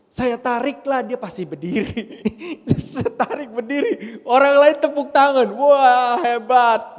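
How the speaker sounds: high, loud laughing retelling. Real, not acted. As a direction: laugh through the story. Laughing while telling trick. (cerita sambil tertawa keras)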